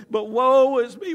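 high, loud, drawn-out lament; mock woe. Believer lamenting their lot, hammed-up woe. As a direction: wail it in hammed-up self-pity. Mimics self-pitying believer.

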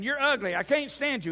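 high-pitched parrot rant; insulting parrot. Acted. Parrot going off on the woman, high-pitched ranting. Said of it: "just going off on, the, on this woman".

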